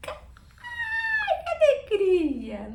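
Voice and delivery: gritinho agudo e lento de alegria, rindo; alegria eufórica. Shown as a demonstration. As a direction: comemore a promoção com euforia. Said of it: "dar uns gritinhos assim, de alegria". (alegria exagerada com risada)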